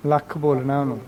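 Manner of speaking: normal talking voice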